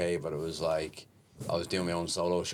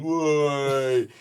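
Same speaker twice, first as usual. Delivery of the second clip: put-on high, loud drunken whoop; 7 semitones higher, 7 dB louder than usual. Drunk husband in the crowd calling out, loud whoop. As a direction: echo the drunk husband's cry. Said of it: "the guy that was going".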